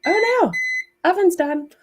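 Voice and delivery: sudden high yelp of surprise at the timer; surprised. Real, not acted. Vlogger startled by the oven timer, high surprised yelp. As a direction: jolt up in pitch, caught off guard.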